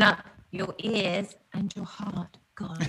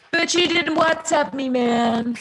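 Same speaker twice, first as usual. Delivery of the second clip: higher, drawn-out teasing protest; mock complaint; 7 semitones higher, 4 dB louder, slower than usual. Bandmate excusing himself for not answering the door, mock complaint. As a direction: play up a slow, high excuse. Acts out excuse to banging friend.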